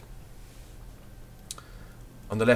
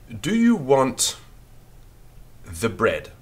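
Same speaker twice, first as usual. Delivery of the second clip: slow, louder dictation of Do you want the bread; 3 semitones higher, 4 dB louder, slower than usual. Shown as a demonstration. Teacher dictating a sentence to pupils, slow and clear. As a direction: dictate it slowly to the class. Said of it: "we write this".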